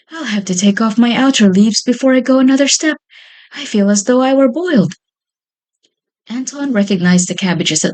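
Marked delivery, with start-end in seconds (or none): 0.1-4.9 out of breath. A narrator's reading. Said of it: "terribly out of breath".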